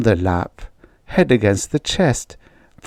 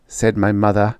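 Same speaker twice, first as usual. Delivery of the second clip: ordinary delivery, nothing marked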